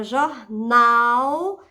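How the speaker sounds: "jornal" with a long, loud "nal"; stress on final syllable. Shown as a demonstration. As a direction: lean hard on the end of "jornal". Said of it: "sílaba tônica". ("jornal" com "nal" forte e alongado)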